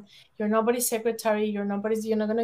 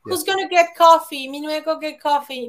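louder, higher mock boss barking an order; bossy boss; 4 semitones higher, 7 dB louder than usual. Boss orders the only woman to fetch coffee, mock bossy. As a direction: play the pushy boss who treats her as the coffee girl.

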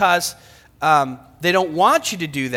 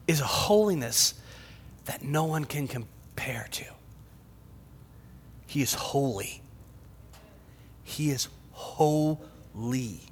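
soft, low, near whisper; slow, reverent murmur; hushed awe; 4 semitones lower, 8 dB quieter than usual. Real, not acted. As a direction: drop to a reverent hush with long pauses. Preacher in hushed awe at holiness.

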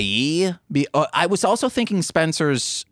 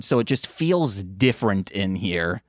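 ordinary talk, nothing marked

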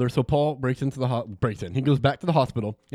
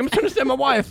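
strained high plea; 12 semitones higher, 4 dB louder than usual. Acted. Choked hero pleading.

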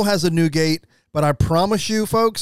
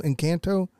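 Encanto with a flat American "a" as in cat, en-KAN-toe; standard american accent; 5 dB quieter than usual. Shown as a demonstration.

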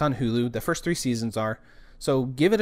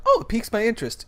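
mildly intrigued; 10 semitones higher, 4 dB louder, faster than usual. Retold or imitated. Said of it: "I'm like".

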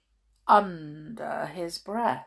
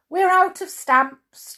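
chuckling boot; 13 semitones higher, 11 dB louder than usual. Acted. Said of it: "chuckled Right".

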